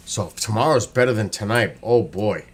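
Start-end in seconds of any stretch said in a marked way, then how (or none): none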